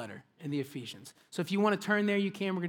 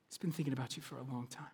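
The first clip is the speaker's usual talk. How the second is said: hushed; 6 semitones lower, 12 dB quieter, much faster than usual. Real, not acted. Repeated hushed line.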